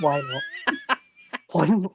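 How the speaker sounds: high laughing reply. Real, not acted. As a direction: laugh through the words. Laughing at own absurd guess.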